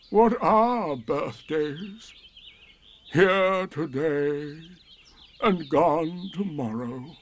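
high, slow, mournful donkey drawl; Eeyore, politely gloomy and slow. A narrator's reading. Eeyore voiced in story.

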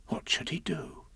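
very soft, near-whispered question; hushed worry. A narrator's reading. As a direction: wonder it under your breath. Piglet's worried question to himself.

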